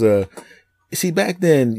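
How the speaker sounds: usual speaking voice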